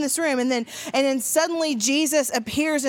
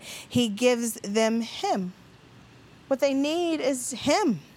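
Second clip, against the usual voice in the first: slow, stressing "him" with a fall; preacher's key point, slowly stressing "him"; emphatic slow point; slower than usual. Acted. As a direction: land "him" slowly and firmly.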